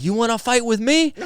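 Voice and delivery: hammed-up Puerto Rican accent challenge. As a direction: tease a buddy in a put-on Puerto Rican accent. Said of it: "my best Puerto Rican accent".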